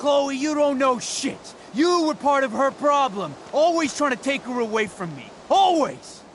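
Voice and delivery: high, angry yelling; heated accusing shout; furious outburst. Acted. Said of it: "Calm down, Frank".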